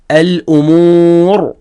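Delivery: slow "الأمور" with a held oo and heavy final R; pausal tafkhim. Shown as a demonstration. Teacher showing exactly how it goes, drawn-out demonstration. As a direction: draw out "الأمور" and rest on a thick R. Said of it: "هكذا".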